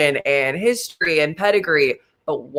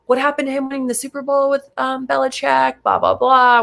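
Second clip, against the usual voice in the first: high, fast, taunting put-on voice; mock the critics' tweets in a higher voice; mocking critics; 9 semitones higher than usual. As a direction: sneer it like a gloating critic.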